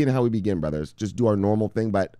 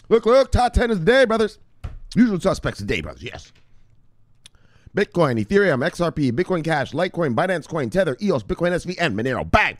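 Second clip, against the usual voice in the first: high, loud announcer call of the top ten; hype show host; 8 semitones higher, 4 dB louder than usual. Acted. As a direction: pump up the brothers like a show opener.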